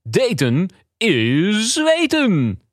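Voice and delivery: an awkward local presenter begging for dates; nervous radio host. Acted. Radio host calls on ladies of Oost-Brabant, nervous and eager.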